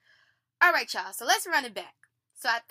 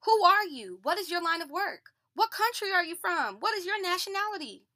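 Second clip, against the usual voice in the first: demanding questions; 7 semitones higher, 6 dB louder than usual. A narrator's reading. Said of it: "they demanded".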